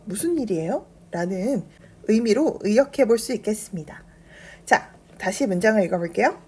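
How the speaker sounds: usual speaking voice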